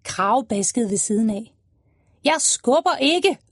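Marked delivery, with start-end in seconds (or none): 2.2-3.4 shouted. Acted. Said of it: "råbte han".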